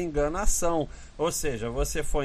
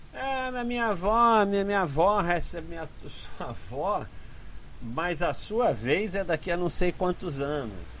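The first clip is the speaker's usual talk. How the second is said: higher, whiny objector's voice; imitating an objector; 5 semitones higher, 4 dB quieter than usual. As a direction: play the naive doubter, higher. Quoting someone's objection about grandma.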